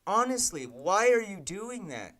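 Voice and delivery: real disbelief boiling over; exasperated yell. Real, not acted. Coder yelling at the broken API, exasperated. Engine bugging again, exasperated.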